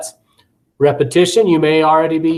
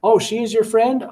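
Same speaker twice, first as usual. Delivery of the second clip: bright, high, encouraging repeat; teacher gently echoing the fixed phrase; upbeat teacher recast; 9 semitones higher than usual. Shown as a demonstration. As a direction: recast it cheerfully, pitched high. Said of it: "That's a recast".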